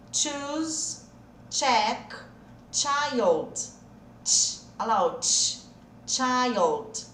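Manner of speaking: put-on choose, check, child each with a crisp tch; model answers. Shown as a demonstration. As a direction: check the words clearly, one by one. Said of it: "Vamos conferir?". (conferindo as palavras com ch)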